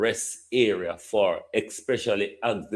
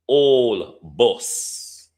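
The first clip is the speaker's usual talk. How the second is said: put-on "old" leaned on with a rising tune, a bit louder; stress on old; 4 dB louder than usual. Shown as a demonstration. MP in parliament insisting on "old", stressing it. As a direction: punch the word "old" in "old bus", slightly louder and rising. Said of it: "we have to emphasize the word old bus".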